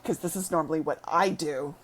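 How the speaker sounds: deadpan mock praise in a deep voice; mock awed. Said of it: "I'm standing there going".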